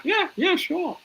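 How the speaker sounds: high, breezy bluff; high-pitched nervous bluff; fake confidence. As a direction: sell it like you've done it before. Bluffing to Maz, faking confidence.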